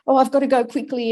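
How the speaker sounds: fast, hurried mock panic; rushed panicky quote. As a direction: rattle it off like a nervous presenter in a rush. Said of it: "you'll start".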